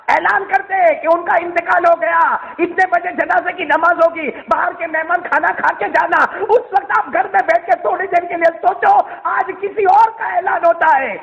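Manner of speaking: urgent, ringing preacher; impassioned preaching. Acted. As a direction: proclaim it at full force, pitched high. Preaches the death announcement.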